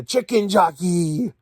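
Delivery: slow, drawn-out dopey movie line; slow mocking Steve impression. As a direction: ham up each item name like a dumb hero.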